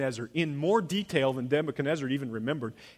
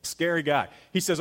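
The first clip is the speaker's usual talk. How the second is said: ordinary talk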